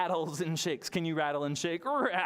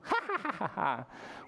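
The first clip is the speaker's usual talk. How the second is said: witch cackle; 4 semitones higher than usual. Acted. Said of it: "a spooky witch with a cackle".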